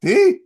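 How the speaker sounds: a sharp, high, loud raspberry blown into the mic; loud lip-buzz fart; mouth fart noise. Retold or imitated. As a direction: blow a fake fart sound. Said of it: "reproducing that sound on the microphone".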